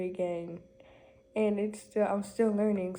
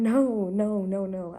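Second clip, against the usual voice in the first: emphatic denial; 7 dB louder, slower than usual. Real, not acted. Denying having a boyfriend.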